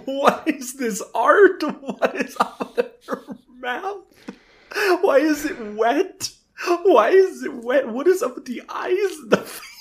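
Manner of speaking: high, tearful disbelief; near-crying high squeal of dismay; appalled, cracking up. Real, not acted. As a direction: wail it in horrified, laughing disbelief. Mock horror at bad comic art.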